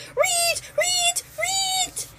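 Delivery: very high squeals of terror; panicked rodent squeaks; terrified guinea pig squeak. A narrator's reading. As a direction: shriek like a tiny rodent. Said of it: "made me scream in terror".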